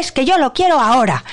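put-on high, demanding kid voice; imitated child digging in; insistent child. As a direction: protest like an impatient little child. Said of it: "Alonso me dice que quiere comer chocolate".

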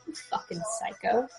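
very soft aside in disbelief; quiet acted disgust from the caller; hushed insult. Caller insults Richie after the rant.

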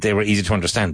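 usual speaking voice

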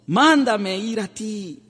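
high loud call, slowing at the end; Peter's cry. Retold or imitated. Peter calling to Jesus on the water, high drawn-out cry. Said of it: "le gritó".

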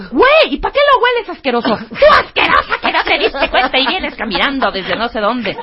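high, loud indignant back-and-forth; mock quarrel. Two friends bickering by the pool, mock indignant. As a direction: play both sides of a bickering fight.

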